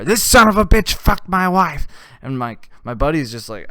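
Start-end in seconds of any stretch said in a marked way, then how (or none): 0.0-1.9 high loud accusing yell across the room. Retold or imitated. Husband yelling the accusation to everyone at the bar, loud high. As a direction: shout it to the whole bar. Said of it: "yelling that out at the bar".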